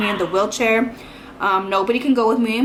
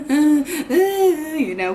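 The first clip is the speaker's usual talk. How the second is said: sobbing the words out, higher; crying; 5 semitones higher than usual. Retold or imitated. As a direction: squeeze it out through tears.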